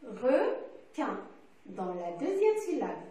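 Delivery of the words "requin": "Requin" cut in two syllables with a pause between; "Requin" in two separate beats; split into syllables. Shown as a demonstration. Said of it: "Dans quelle syllabe j'entends le son un?".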